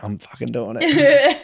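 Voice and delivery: put-on high, slow, laughing cheeky kid voice; mock a defiant little boy through laughter. Brother defying mum in the supermarket, high cheeky laughing voice. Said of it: "And he'd go".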